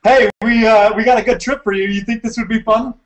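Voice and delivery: high, lightly salesy pitch to a recruit; mock pitch. Pitching a Mars trip to a friend, mock salesman. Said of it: "Just convince him, like".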